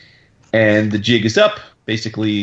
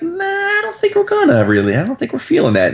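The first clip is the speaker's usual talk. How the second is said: mock reluctant refusal. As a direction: imitate someone casually wriggling out of a request. Retelling a casual, dismissive refusal.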